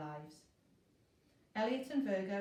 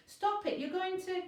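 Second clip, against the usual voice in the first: alarmed warning; 10 semitones higher, 5 dB louder than usual. Acted. Elliot alarmed, warning Virgo.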